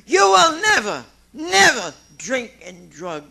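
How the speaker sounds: loud, high warning on never; preaching emphasis. Real, not acted. Speaker warning the newcomers in the room, loud. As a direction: hammer home the warning.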